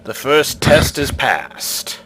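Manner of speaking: slow, solemn guardian approval; stone face voice. Acted. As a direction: pronounce the verdict like a stone idol.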